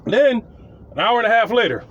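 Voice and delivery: ordinary talk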